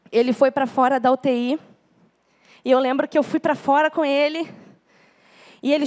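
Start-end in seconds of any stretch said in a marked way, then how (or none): none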